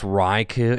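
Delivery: Raikou said as RYE-koo; grew-up pronunciation. Shown as a demonstration. Host asking viewers how to say the name, Raikou as RYE-koo. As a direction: end Raikou on a long "oo". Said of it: "It's not Raikou, which is what I h-, grew up saying".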